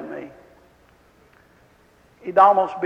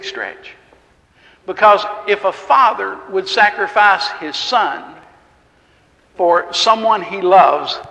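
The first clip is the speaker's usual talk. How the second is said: full-force preaching; 3 semitones higher, 5 dB louder than usual. Acted. Preacher urging the congregation to believe, fervent and loud.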